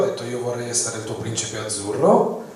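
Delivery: ordinary delivery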